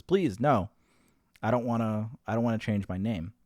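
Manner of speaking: mildly urgent retold plea; pleading. Son begging the secretary to stop the name change, pleading.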